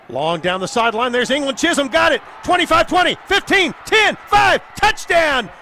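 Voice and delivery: building from brisk to full shout; rising play-by-play yell; excited touchdown call. Real, not acted.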